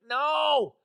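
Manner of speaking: a sudden, sharp shouted no; loud flat no. Pretend. To the clients asking to pay early, a blunt shouted no. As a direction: blurt a loud, high refusal. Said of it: "I just wanna be like".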